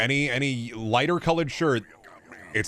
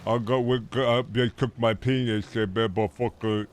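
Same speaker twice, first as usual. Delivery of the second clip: mock chud; 3 semitones lower, slower than usual.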